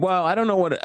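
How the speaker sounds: ordinary delivery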